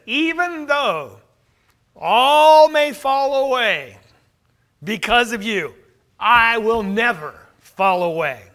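loud, drawn-out vow of loyalty; self-reliant boast. Retold or imitated. Peter vows to Jesus he will stay, proudly emphatic. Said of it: "notice the emphasis on the word "I".

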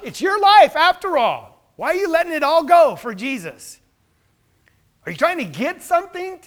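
usual speaking voice

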